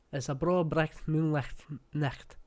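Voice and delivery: slow Scottish 'bro bricht moonlicht nicht' with throaty ch; broad Scottish 'moonlicht nicht', every ch rasped; Scottish tongue twister. Shown as a demonstration. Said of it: "that's like a Scottish tongue twister".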